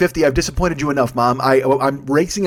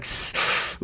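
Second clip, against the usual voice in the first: pained hiss; 5 dB quieter than usual. Retold or imitated. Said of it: "You're like".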